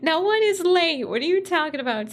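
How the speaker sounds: loud, high-pitched exclamation; playful protest. Real, not acted. Streamer to a viewer acting late, loudly protesting. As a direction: burst out high in disbelief.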